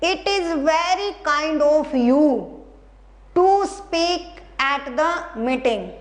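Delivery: sentence read again very slowly; slow reading aloud. Shown as a demonstration. Teacher rereading the sentence for students, very slowly. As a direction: read each word slowly and deliberately. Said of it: "क्या है?".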